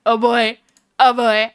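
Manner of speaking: ordinary delivery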